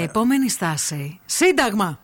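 slow, measured metro announcer; rehearsed station announcement. Shown as a demonstration. Host doing a trial run for her co-host, slow announcer. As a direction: announce the stop slowly and deliberately. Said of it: "κάνε μια πρόβα".

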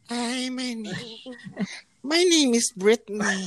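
put-on high, slow, girly guest voice. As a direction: play a sweet-voiced guest on the phone. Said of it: "may we call on our special guest".

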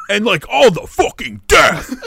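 loud, high gleeful outburst; excited. Real, not acted. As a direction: shout it with glee. Excited metal enthusiasm.

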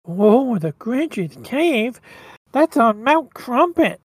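slow, drawn-out whiny reindeer; hesitant nasal reindeer voice; whiny, nasally Rudolph. Acted. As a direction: react in a nasal cartoon whine. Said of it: "he's got that kinda really whiny, nasally voice".

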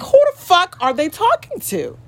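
very high-pitched incredulous demand; indignant inner self. Acted. Subconscious self snatching her up, high and incredulous. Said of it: "literally be snatching me the fuck up like".

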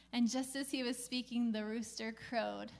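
ordinary delivery

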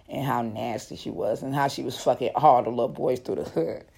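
subdued, pensive storytelling; hushed reflection. A narrator's reading. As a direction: drop low and quiet, as if lost in thought. Recalling her shameful past.

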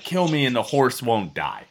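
normal talking voice

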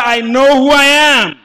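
full-voiced, high, slow shout; preacher's loudest round of the chant. Shown as a demonstration. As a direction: shout it as loud as you can.

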